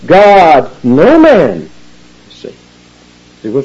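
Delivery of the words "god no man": "God" and "no man" said loud and separate, one by one; emphatic word order. Shown as a demonstration. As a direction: hit "God" and then "no man" hard. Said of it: "It's like this".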